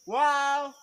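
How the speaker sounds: high, slow call out across the jungle; echo call. Real, not acted. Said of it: "That is echo".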